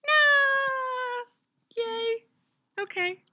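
very high-pitched squealed outburst; tiny, sky-high exclamations. Real, not acted. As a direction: squeal it far above your normal voice.

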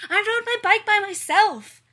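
put-on high, very loud proud kid; excited child shouting. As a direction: shout it out like an eager preschooler. Excited child answers teacher in class.

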